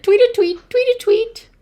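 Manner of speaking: high, loud quick twitter; starling tweeting. A narrator's reading. Starling in the noisy recap, high loud twittering. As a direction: tweet it like an insistent little bird. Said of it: "The starling said".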